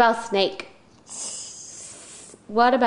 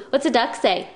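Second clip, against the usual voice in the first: ordinary delivery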